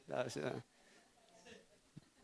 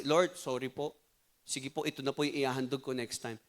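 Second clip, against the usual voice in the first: soft, low, meek plea to God; humble apology; 5 semitones lower, 7 dB quieter than usual. Pretend. Cain humbly apologising to the Lord, soft meek voice. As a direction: say sorry to the Lord quietly and humbly.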